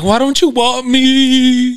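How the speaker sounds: put-on high, slow, pleading girl voice; mock the stripper's needy plea; drawn-out high voice, teasing; mock whiny girl. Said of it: "It's like".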